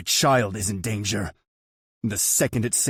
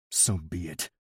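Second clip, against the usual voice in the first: icy; 2 semitones lower, 3 dB quieter than usual. A narrator's reading. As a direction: say it coldly. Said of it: "I reply icily".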